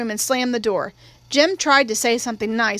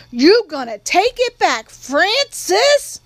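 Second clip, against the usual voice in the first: demanding; 7 semitones higher, 5 dB louder, much slower than usual. A narrator's reading. Girl confronts cousin at door.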